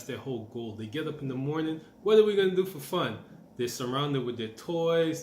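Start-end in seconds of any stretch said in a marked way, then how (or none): 2.0-3.2 much higher and louder, bursting with excitement; eager kid. Retold or imitated. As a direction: ask it like a kid who just woke up thrilled. Kids waking up eager for fun.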